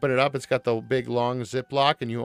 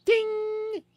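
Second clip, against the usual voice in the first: much higher cartoon "Ding!"; 3 dB louder than usual. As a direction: chime it like a winking cartoon mascot. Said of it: "wink and be like".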